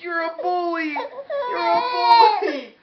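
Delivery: high, loud sobbing shout; wailing crybaby accusation. Retold or imitated. Parent voicing the crying baby, blaming dad, in a sobbing high wail. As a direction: wail it out like a tired baby in tears. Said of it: "He's like".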